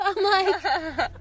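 ordinary talk

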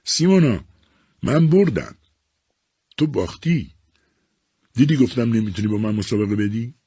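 put-on young man shouting his win to Simona. A narrator's reading. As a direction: crow over the win. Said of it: "مرد جوون فریاد زد".

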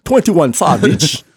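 drawn-out British take on a name; teasing British accent; mock British accent. Mocking the rapper's British origins.

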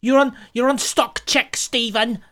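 lightly hammed-up Cockney boss, dismissive; mocking bad British boss. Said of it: "It was very much like".

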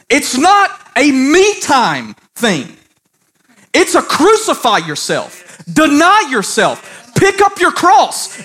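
high, impassioned sermon delivery; full-force preaching. Real, not acted. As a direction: preach it hard, driving each phrase home.

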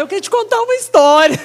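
fast, loud and high, bubbling over; excited. Retold or imitated. Preacher eager to tell the saleswoman her testimony. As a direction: rush the offer with glee.

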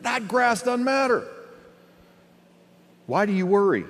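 high-pitched, incredulous reproof; mild rebuke. Acted. Jesus asking why they worry, mildly rebuking. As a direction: press the point, gently chiding. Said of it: "little bit of rebuke in there".

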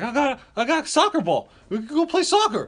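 put-on high, loud eager kid voice; so excited. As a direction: imitate a kid bursting to play. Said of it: "He's so excited".

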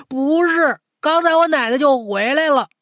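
put-on high, insistent little boy; protesting child. A narrator's reading. Grandson insists grandmother came back, high childish protest.

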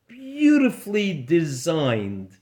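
ordinary delivery

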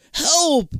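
high, loud scream for help. Acted. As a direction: scream out in fear. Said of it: "Jake screams again".

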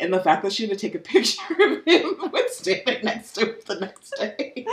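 real laughter breaking up the words; laughing while talking. Real, not acted. Host recounting the absurd photo to her co-host, cracking up. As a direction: crack up as you tell it.

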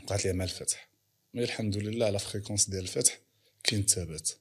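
normal talking voice

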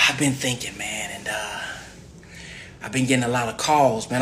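acted musing. Acted musing about calls.

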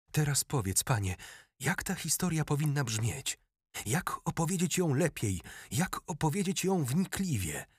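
excited pupil begging his master. A narrator's reading. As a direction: press the king for an answer, thrilled. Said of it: "wykrzyknął niesamowicie podekscytowany Garcer".

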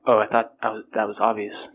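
ordinary talk, nothing marked

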